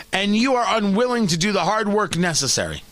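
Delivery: normal talking voice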